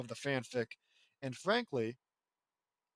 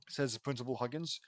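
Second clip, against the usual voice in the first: usual speaking voice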